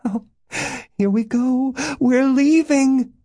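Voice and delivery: very high excited cry; breathless, elated. A narrator's reading. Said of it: "Breathless, laughing".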